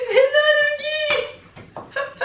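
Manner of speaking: high laughing protest. Real, not acted. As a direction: laugh through it, amused at the dog. Laughing as dog fails.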